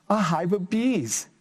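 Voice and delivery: put-on lively, sing-song story reading for kids; reading to children. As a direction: bring the picture book alive for little listeners. Reads picture book aloud.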